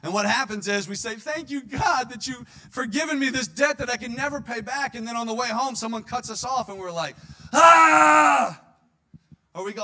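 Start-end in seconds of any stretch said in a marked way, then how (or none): 1.2-5.0 high, loud overjoyed thanks; gushing thanks. Acted. Mock prayer of thanks to God. 7.5-8.6 sudden furious shout, much higher and louder; road rage yell. Acted. Someone cuts us off driving.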